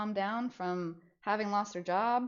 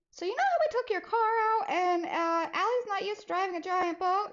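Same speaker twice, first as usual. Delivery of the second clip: much higher, anxious tiptoeing voice; nervous; 10 semitones higher than usual. Retold or imitated. To mom about the car, nervous and high. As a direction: ease into the bad news.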